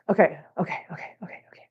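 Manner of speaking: whispered, breath-only self-calming; fighting back real tears; trying not to cry. Real, not acted. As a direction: whisper it to steady yourself through tears. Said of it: "All right, stop crying. Calm down".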